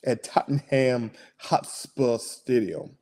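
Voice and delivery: slow, low mock London accent; deliberate, drawn-out London accent. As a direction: announce it in a put-on London accent. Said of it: "that's my London voice".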